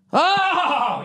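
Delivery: high, sudden oh; startled high exclamation; surprised oh. Real, not acted. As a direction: catch yourself mid-rant. Catching himself mid-rant.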